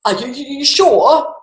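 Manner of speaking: high, shaky disbelieving stutter; father doubting his son's dragon tale; stammering father. A narrator's reading. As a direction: stumble over the words in fright. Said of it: "said the father".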